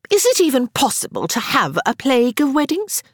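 high, pointed question; change the subject emphatically; said loudly. A narrator's reading. Harriet turns back to Sarah to divert attention, loudly. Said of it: "Harriet said loudly".

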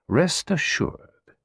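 slow, unhurried foreign detective voice; Poirot, self-assured. Acted. Poirot assuring his client of his skill, slowly. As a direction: savour each word with calm pride.

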